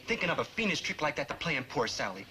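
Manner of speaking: ordinary talk, nothing marked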